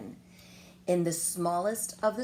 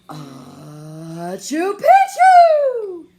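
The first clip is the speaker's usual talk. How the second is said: very slow, loud, higher, drawn-out sneer; sneered password; 9 semitones higher, 17 dB louder, much slower than usual. A narrator's reading. El Skipito saying the secret password into Don Diego's ear, dramatic slow sneer. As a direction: stretch every syllable dramatically. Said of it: "half sneered, half spoke".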